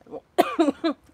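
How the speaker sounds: sharp cough, choking on tea. Real, not acted. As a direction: cough out a swallowed sip of tea. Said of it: "why I'm choking on my tea".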